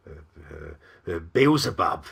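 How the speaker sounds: falter, then get the name out; hesitant stammer. A narrator's reading. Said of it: "it said hesitantly".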